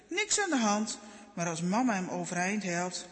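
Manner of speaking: ordinary delivery, nothing marked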